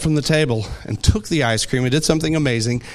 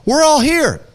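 high, urgent call, moderately raised; shouted; 10 semitones higher, 3 dB louder than usual. A narrator's reading. Paul calling to the jailer, shouted as read aloud. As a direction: call out to stop him, pitched way up. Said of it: "But Paul shouted".

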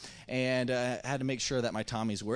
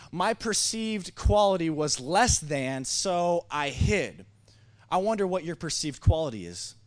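slow, raised, louder sermon delivery; emphatic preaching; 5 semitones higher, 6 dB louder than usual. Real, not acted. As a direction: land each phrase deliberately for the congregation. Preaches the point to congregation.

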